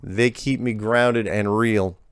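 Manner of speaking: unhurried backwoods American twang; folksy rural accent. Retold or imitated. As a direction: read the letter like an old country farmer. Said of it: "He's not breaking character".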